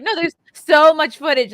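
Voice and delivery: high, loud protest; emphatic retort. Acted. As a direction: fire back loudly at a critic. Acting out a retort to critic.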